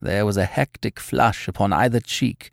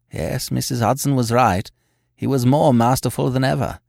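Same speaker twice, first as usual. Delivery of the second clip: usual speaking voice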